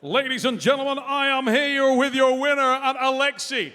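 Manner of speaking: loud, high ring announcer call; booming pumped announcer. Acted. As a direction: boom it out to the arena. Host opens post-match interview.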